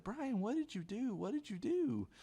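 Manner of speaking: very high, drawn-out mock girl's voice; alarmed classmate. Girl sitting beside him asks what he did, mock high-pitched alarm. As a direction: imitate her in a slow, squeaky high pitch.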